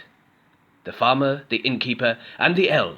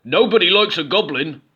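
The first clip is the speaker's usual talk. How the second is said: higher, louder troll warning; 7 semitones higher, 6 dB louder than usual. A narrator's reading. Troll warning Goblin, loud higher troll. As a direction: warn him as the troll. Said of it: "said the troll".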